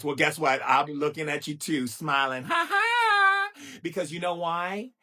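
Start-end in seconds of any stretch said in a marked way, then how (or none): 2.4-3.5 a high, far-off gleeful "ha ha"; high mocking cackle. At the people staring sideways, a high gleeful cackle. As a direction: cackle right back at them. Triumphant laugh.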